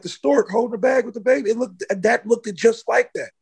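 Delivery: high, excited retelling of a friend's claim; imitating friend. Friend insisting the post looked like the stork picture, high and amazed.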